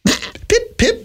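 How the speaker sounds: high, tiny British squeak; mock British accent. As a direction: chirp it like a cartoon Brit. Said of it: "That's the wrong accent".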